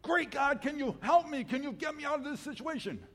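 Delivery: high, loud plea, voice straining up; climber pleading upward. Acted. Climber on the branch begging God above to save him, high and pleading. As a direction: beg to be rescued.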